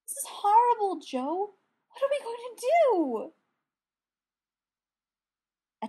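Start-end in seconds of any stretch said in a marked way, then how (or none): 0.1-3.3 high, loud upset cry; pleading in distress, high and loud. A narrator's reading. Brendan upset over trapped unicorn.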